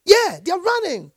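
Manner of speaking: high, loud triumphant preaching; preacher exulting over angels; exultant preaching. Real, not acted. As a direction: proclaim it like marching orders.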